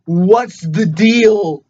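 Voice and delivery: loud, very slow, halting impression; Christopher Walken. Podcaster doing a bad actor impression on airline food, slow and loud. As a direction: drag it out loud and high with odd gaps. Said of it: "I have to turn into Christopher Walken".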